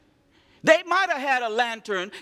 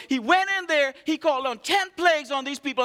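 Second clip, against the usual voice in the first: ordinary talk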